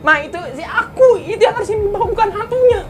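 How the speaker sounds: very high, laughing squeal; mock protest to Mama. Child tells Mama she took the wrong one, high laughing protest. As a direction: plead in a high voice through laughter.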